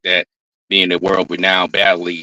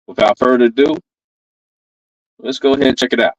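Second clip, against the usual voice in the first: louder, higher host hype-up; hype intro; 5 semitones higher, 3 dB louder, faster than usual. Real, not acted. Host hyping the audience before the battle, louder and higher. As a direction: pump up the crowd before the clip.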